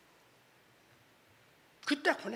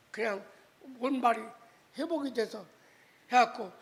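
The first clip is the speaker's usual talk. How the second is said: ordinary talk, nothing marked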